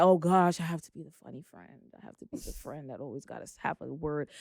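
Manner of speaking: quiet inner worry. Retold or imitated. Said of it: "I used to always think that".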